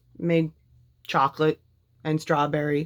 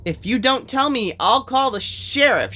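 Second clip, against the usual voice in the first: higher, rising threat; angry shout; 7 semitones higher, 3 dB louder than usual. A narrator's reading. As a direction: threaten to call the sheriff. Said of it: "shouted Lord Gur".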